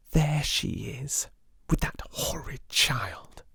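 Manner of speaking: spiteful whisper, slow. Acted. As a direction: hiss it so she can't hear. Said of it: "exclaimed Lavinia in a whisper".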